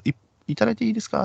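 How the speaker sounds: high, quick sugary hostess voice; hostess asking. Retold or imitated. Hostess begging the customer for a drink, high and quick. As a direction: put on a cute pleading club girl.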